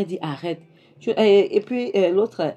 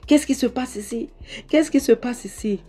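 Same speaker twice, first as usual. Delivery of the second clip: higher-pitched, alarmed demand; raised, indignant retelling of his outburst; agitated demand; 8 semitones higher than usual. Retold or imitated. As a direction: act out an upset man demanding answers. Jonathan storms in on the scene.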